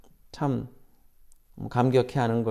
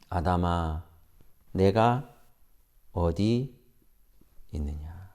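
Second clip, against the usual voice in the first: low, soft and slow, fading to almost nothing; gentle voice of God; 9 semitones lower, 6 dB quieter, slower than usual. Acted. God softly asks hiding Adam, slow and tender. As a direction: ask tenderly, already knowing the answer. God's gentle question repeated.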